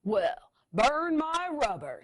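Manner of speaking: loud, slow big-truck exclamation; tough truck. Acted. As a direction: boom it out like a monster truck.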